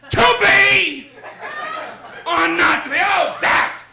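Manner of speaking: Jackie Gleason doing Shakespeare. Acted. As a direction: boom it out like a big-mouthed TV comic playing Hamlet. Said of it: "Here is Jackie Gleason doing Shakespeare".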